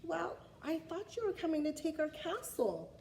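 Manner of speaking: a lightly voiced sheepish king; king explaining. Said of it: "The king looked at the dragon".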